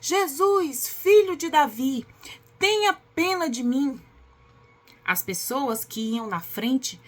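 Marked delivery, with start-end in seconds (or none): 0.0-4.0 loud, higher-pitched plea for mercy; raised, projected storyteller's shout; crying out. A narrator's reading. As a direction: call out like a blind beggar pleading over a crowd. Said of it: "o cego começou a gritar".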